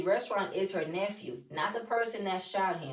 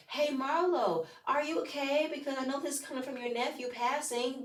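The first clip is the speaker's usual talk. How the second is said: higher, softer imitation of sympathy; soft, high pretend sympathy; mock concern; 5 semitones higher, 5 dB quieter than usual. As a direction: act out a gentle check-in, pitched up. Acting out someone's concerned check-in.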